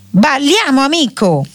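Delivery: light, high-pitched cry; storybook calling out. A narrator's reading. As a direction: call out eagerly to a dance partner. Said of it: "gridò all'uomo".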